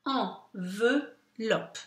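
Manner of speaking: "Enveloppe" softly broken into three slow syllables. Shown as a demonstration. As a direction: recap "Enveloppe" slowly, each syllable apart. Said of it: "dans la deuxième syllabe".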